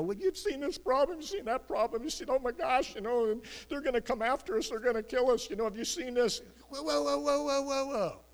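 hammed-up high-pitched frantic babble; mock panic. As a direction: act out terrified men spiralling into wailing "whoa". Acting out panicking men of Israel.

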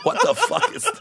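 squealing laugh breaking into words; shocked laughter. Real, not acted. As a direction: laugh it out in high-pitched disbelief.

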